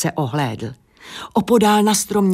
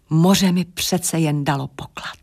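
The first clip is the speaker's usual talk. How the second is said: ordinary delivery, nothing marked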